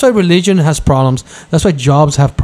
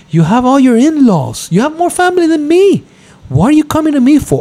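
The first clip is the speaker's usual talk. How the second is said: higher, indignant pitch climbing; heated retort; 11 semitones higher than usual. Retold or imitated. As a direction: push up in disbelief. Heated rant at sister.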